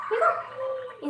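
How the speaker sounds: sweet high voice handing over food; high pet talk. Real, not acted. Offering food to dog.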